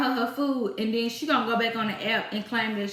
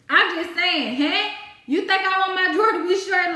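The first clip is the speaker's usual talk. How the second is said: louder, higher, exasperated; heated repeat; 7 semitones higher, 5 dB louder than usual. Real, not acted. Cashier venting at a cheese-demanding customer, exasperated and loud. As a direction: push it harder the second time. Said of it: "repeats her retort louder".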